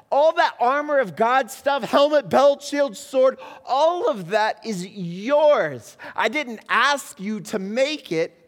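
loud, high preaching with slow emphatic endings; full-force sermon climax; fervent preaching. Acted. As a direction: preach it out to the congregation.

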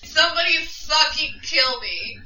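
hammed-up hangover agony; hungover groaning. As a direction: play a wretched, hungover drinker begging for relief. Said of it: "you're just sitting over here just like".

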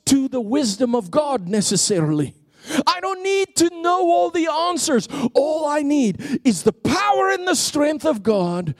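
high, ringing preacher's voice, building; fired-up preacher driving home the point; full-force preaching. Acted. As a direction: preach it out to the whole room.

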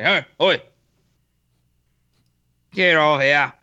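Irish guard's shout slipping into Australian; botched Irish accent. Pretend. Drunk Irishman guarding whiskey barrels warns off intruders, botched Irish accent. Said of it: "That turned Australian".

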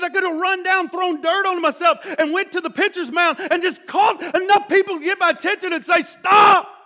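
loud, high, building to a shouted stop; preaching shout. Retold or imitated. Preacher retelling the pastor's wish, shouting loud and high. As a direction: cry it out with rising force.

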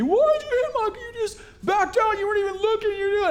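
very high-pitched mock scream; retold screaming. Strangers yelling at pastor over car, high mock scream. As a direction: shriek it like furious strangers. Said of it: "they're just screaming at me".

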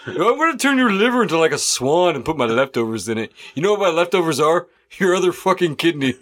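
fast, casual killer boast; calm psychopath. Acted. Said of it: "That's a good serial killer voice".

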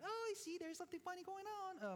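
high, sly knowing voice; mock the gossips with a high sneer; hammed-up suspicious onlooker; mock insinuating. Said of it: "some people looking at it like".

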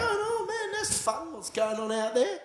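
breezy imitation of a relaxed onlooker; sing-song pretend calm; mock carefree. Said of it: "sitting there going".

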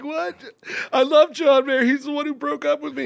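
high, loud, hammed-up dumped fan; hammed-up heartbroken fan; shocked fan.

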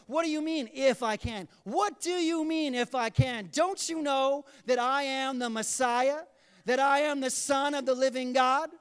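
acted disbelief, loud and insistent; incredulous. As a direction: challenge them with astonished emphasis, stretching the words. Jesus' incredulous reply, acted out.

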